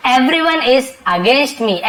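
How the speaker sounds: very slow example sentence; slow teacher demo; slow dictation. Shown as a demonstration. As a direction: read it slowly for learners. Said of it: "slow model sentence".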